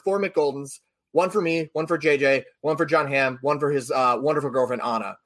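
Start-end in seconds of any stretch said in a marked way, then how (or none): none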